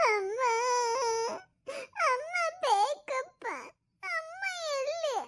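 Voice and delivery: put-on high, slow tearful little boy calling for his mother; little boy sobbing for his mom; crying child. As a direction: whimper Amma like a lost small child.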